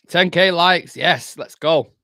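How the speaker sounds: loud, high celebration; cheering as the likes hit ten thousand; excited cheer. Real, not acted. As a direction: shout it out in triumph.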